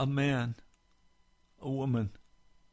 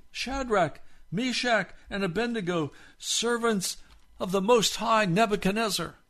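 higher mocking take of the king's call; mock wrong version; 11 semitones higher, 6 dB louder than usual. Shown as a demonstration. Pastor mocking the wrong words of the king, higher and light. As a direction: parody what the king did not say. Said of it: "he does not say".

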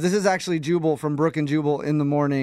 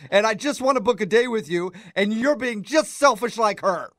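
higher, raised complaining voice; heated family shouting; 6 semitones higher than usual. Acted. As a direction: push the grievance, heated. Mock quarrel escalating.

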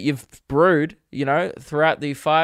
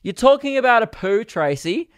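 higher and louder in disbelief; half-laughing protest at a TV host about poo; exasperated outburst; 8 semitones higher, 4 dB louder than usual. Real, not acted. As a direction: throw it back at her, incredulous.

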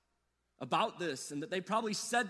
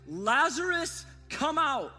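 loud command; 5 semitones higher, 5 dB louder, slower than usual. Acted. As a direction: call Lazarus out loud and higher, stretching his name. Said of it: "called in a loud voice".

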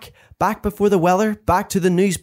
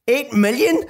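mock scream; 7 semitones higher than usual. A narrator's reading. Mum learning the view count, screaming in disbelief. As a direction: query it in a contained, high-pitched scream. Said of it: "she screamed".

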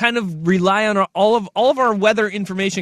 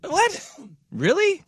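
surprised; 10 semitones higher, 5 dB quieter, slower than usual. Real, not acted. Shocked by long covid news.

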